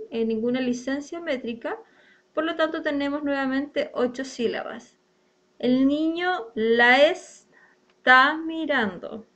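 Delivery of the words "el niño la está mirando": slow, every syllable spaced out; scanning syllables. Shown as a demonstration. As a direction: spell the verse out in beats. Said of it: "tenemos otra sinalefa". (lento, marcando cada sílaba)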